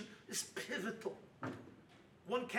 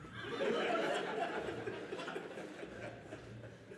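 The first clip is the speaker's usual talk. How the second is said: breathy laugh; 4 dB quieter than usual. Real, not acted. After his own joke.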